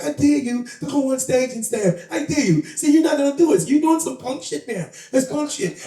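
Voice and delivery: high, taunting mimicked woman; imitating a woman friend. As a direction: needle him in her high voice. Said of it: "That's how she talks".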